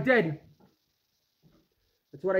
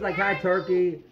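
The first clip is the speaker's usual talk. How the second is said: usual speaking voice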